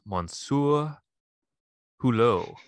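lightly French Monsieur Hulot; a hint of French on Monsieur Hulot; French accent. Shown as a demonstration. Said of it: "Working on my French accents here".

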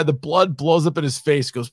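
ordinary talk, nothing marked

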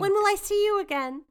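high, bright spoiled heiress; London voice. Acted. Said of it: "You will be London".

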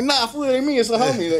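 high, loud mock protest; high-pitched denial. Hiding in the truck from fans, high-pitched denial. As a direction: fend them off, hammed-up and high. Pretends to be someone else, hiding.

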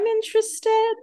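high, drawn-out squeal of interest; mock keen interest. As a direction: stretch it out way up high, teasingly. Jokingly voicing a character's keen interest.

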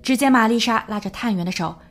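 ordinary talk

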